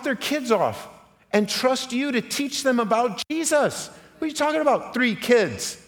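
high, loud impassioned preaching; preaching at full force. Acted. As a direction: build it up and shout it to the congregation.

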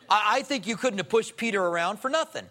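ordinary talk

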